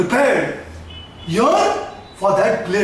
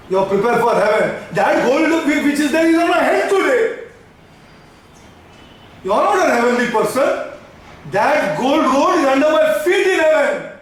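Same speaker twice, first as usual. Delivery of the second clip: ordinary talk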